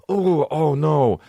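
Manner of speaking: high, drawn-out fake horror; mock dismay. Viewer watching animals get shot on the show, mock dismay. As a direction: wail a little in put-on alarm.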